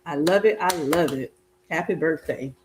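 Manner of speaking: ordinary delivery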